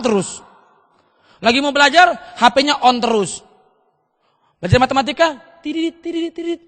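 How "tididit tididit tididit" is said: high, soft electronic beeping; imitated phone beeps. Pupil on phone during maths, high beeping noises. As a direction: chirp little high beeps.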